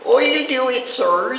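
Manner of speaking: put-on high eager rustic country accent. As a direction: speak it like a country bumpkin. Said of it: "who had a strange rustic accent".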